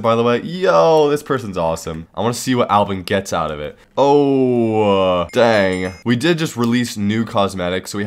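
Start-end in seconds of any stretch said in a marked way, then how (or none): none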